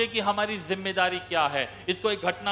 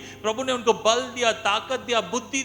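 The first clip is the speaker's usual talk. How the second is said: driving, raised list cadence; rousing preaching; 3 semitones higher, 3 dB louder than usual. Acted. Preacher listing what the Lord gave, rousing. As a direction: pile up each blessing forcefully.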